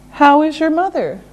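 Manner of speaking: quick connected question; natural brisk pace. Shown as a demonstration. Teacher repeating the question at brisk natural pace. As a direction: run the words together conversationally. Same question at faster speed.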